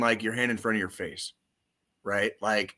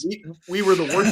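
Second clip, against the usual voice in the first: louder, higher, worked-up insistence; indignant sports fan cry; dramatic protest; 7 semitones higher, 5 dB louder than usual. Real, not acted. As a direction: protest like a wronged fan, pitched up.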